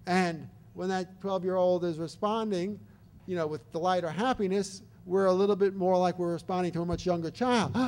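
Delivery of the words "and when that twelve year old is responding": usual speaking voice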